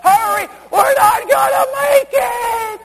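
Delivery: high, loud panicked wail, slowed right down; mocking a weak church. Preacher mocks timid churches with a panicked, high shouted wail. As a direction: ham up a timid church member crying out for rescue. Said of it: "weak, timid churches trying to hang on".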